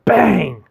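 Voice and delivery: high, loud bang; explosive mock gun noise; shouted gunshot imitation. Narrator mimicking the dog's gun, shouted.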